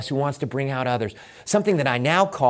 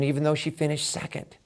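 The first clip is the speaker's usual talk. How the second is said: ordinary delivery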